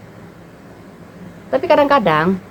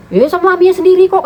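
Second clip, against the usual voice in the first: husband's defensive reply; 10 semitones higher, 5 dB louder than usual. Retold or imitated. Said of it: "kata laki aku".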